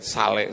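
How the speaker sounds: "sale" in two syllables, sa-lé; jokey Indonesian reading. Shown as a demonstration. Preacher teasing shoppers who spot a sale, "sale" said sa-lé. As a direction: say "sale" as SAH-leh, Indonesian style. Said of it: "orang Indonesia kan nggak boleh lihat sale".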